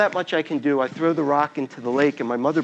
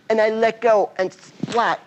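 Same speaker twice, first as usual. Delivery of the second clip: ordinary delivery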